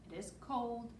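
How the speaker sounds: plain reading voice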